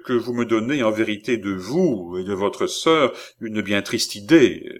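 ordinary delivery

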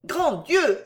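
high-pitched cry of dismay; involuntary exclamation. A narrator's reading. A woman caught off guard by his words, exclaiming mechanically. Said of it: "s'écria-t-elle machinalement".

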